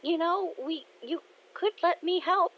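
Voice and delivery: put-on high-pitched young girl voice; daughter offering help. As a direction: pipe up as the helpful kid.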